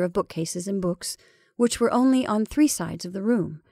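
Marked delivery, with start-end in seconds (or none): none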